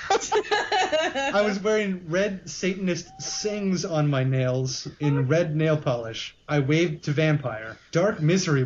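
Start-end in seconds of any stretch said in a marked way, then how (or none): 0.1-3.8 slow, higher, through laughter; laughing reread. Real, not acted. Reader rereading the line, laughing slowly. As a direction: reread it cracking up. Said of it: "how did she get changed".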